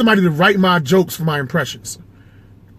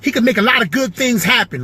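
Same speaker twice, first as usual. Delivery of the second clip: high, animated point-guard impression; Avery Johnson; 5 semitones higher than usual. Ex-point guard explaining giving David the ball, high Avery Johnson voice. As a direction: pitch it way up, very animated. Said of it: "I got Avery Johnson".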